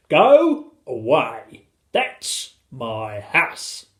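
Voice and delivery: slow, forceful order; drawn-out indignant shout; calling out. A narrator's reading. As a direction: call it down word by word. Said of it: "called the tiny Mister Gregg".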